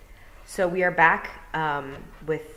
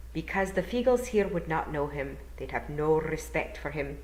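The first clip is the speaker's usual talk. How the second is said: gentle Scottish burr, rolled r's; Scottish old Feagle; 2 semitones higher than usual. Acted. William explaining to Tiffany, courteous Scottish burr. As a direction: speak as a courteous Scottish elder.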